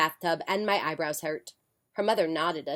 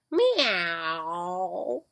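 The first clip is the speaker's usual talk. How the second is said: cat meow. Acted. Cat answering the mother at the door with a meow. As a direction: purr out a little meow.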